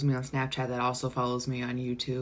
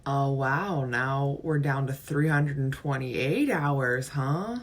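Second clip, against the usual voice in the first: ordinary talk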